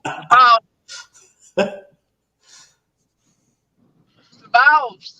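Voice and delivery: mock voice. Repeating vowels in mock voice.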